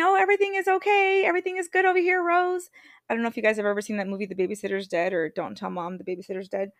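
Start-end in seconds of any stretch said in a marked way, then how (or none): 0.0-2.7 much higher, bright forced everything-is-fine voice; fake cheerful coping. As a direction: chirp it out through a frozen smile. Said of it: "I'm standing here with my teeth clenched and I'm smiling".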